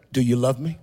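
low, gentle asking; tender question. Acted. Jesus asking failed Peter one question, tender and low.

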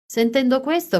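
usual speaking voice

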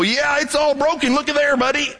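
high, offhand doctor brushing it off; a lightly voiced callous medic; breezy doctor waving off a broken arm; careless doctor. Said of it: "you go".

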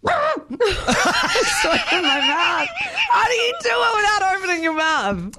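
laughing through a failed attempt; giggling while trying. Real, not acted. Laughing while trying closed-mouth singing.

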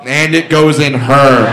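slow, deep gloating retort; smug punchline. Retold or imitated. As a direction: lean on goes and savor it. Punchline comeback to heckler.

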